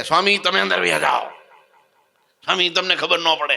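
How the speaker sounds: high, urgent pleading; panicky plea. Retold or imitated. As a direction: beg him to hide, alarmed. Frightened devotee begs swami.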